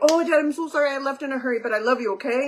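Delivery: high, sweet apology to dad; apologetic. Retold or imitated. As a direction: coax dad with a mock-sweet sorry. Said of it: "And I'd be like".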